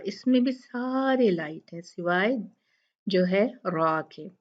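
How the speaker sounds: usual speaking voice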